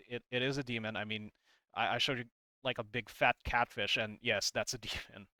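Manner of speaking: normal talking voice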